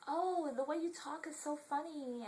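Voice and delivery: high, drawn-out mock stranger voice; slow it down and pitch it way up, mimicking them; imitating strangers. Shoppers remarking on her accent, imitated in high voice. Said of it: "these people were like".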